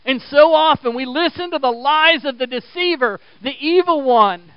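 loud, high pulpit warning; impassioned preacher's denunciation; full-force preaching. Real, not acted. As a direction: warn the congregation with force.

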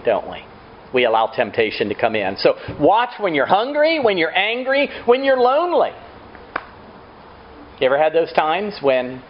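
ordinary talk

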